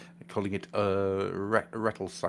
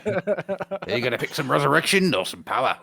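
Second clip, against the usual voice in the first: lightly put-on Cockney Cenobite; 5 semitones higher than usual. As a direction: play a cheeky Cockney demon. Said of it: "Cockney working class Cenobites".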